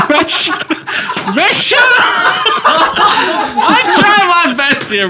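very high, drawn-out squeal through laughter; laughing protest. Real, not acted. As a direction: protest loudly while cracking up. Laughing protest while being teased.

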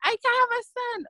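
high-pitched, imitated domestic fretting; agitated retelling. Wife and husband arguing over the incident, high and agitated. Retold heated exchange between spouses.

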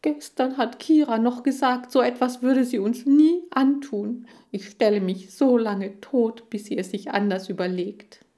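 indignant dismay, easing toward the end; dismayed protest. Acted. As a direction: complain as if betrayed. Lee protests, still horrified.

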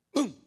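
quick mouthed boom; mock explosion boom. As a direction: pop out the blast. Imitating mortar blast.

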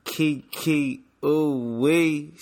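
drawn-out hype name call; slow hyped-up name drop; hype intro. Acted. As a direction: stretch the name out like an announcer. Hyping up guest name.

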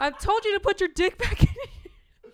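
talking through laughter; words broken by laughing. Real, not acted. Laughing fit.

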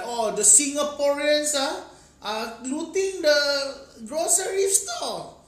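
high, loud, slow scoffing voice; mocking foreigner voice. Mocking foreigners' view of Singapore.

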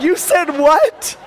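slow, high, loud stunned question; acted incredulity. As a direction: drag it out in hammed-up shock. Parent's disbelief, retold.

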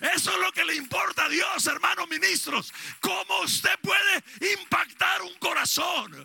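loud, high preaching shout; fiery preacher shouting to his fellow ministers; full-force preaching. Acted.

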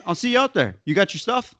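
high, breezy mock reassurance; playful high-pitched imitation; teasing high voice. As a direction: brush him off in a lilting high voice. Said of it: "He's like".

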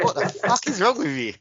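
high, amused mock outburst; laughing disbelief. Reacting to a contestant's choice, laughing disbelief. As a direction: break into a laugh mid-question.

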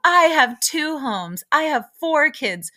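high, loud imitated shout; mock yelling out a brag; retold yell. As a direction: bellow it like a petty boast. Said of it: "stands up and yells".

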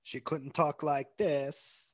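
slow, drawn-out mock British accent; badly faked British, stretched out slowly; hammed-up British impression. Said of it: "That was a really bad impression".

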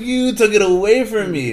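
loud, high mock-bitter outburst; acted accusation. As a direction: hurl blame theatrically. Acts Steve's accusation.

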